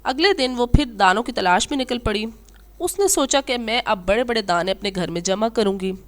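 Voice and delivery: plain reading voice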